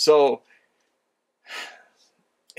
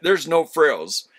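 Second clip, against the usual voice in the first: ordinary talk, nothing marked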